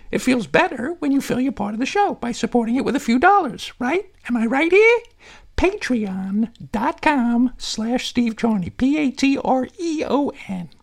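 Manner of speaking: chirpy high puppet reading an ad. Acted. Puppet Harry reads the ad.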